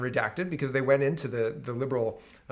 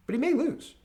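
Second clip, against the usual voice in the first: much higher mock "may lose"; 10 semitones higher than usual. Mocking those who say Trump lost, in a high voice. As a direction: sneer it up high.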